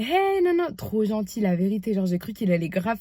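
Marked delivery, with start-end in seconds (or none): 0.0-0.7 friendly greeting. Retold or imitated. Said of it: "Il m'a fait".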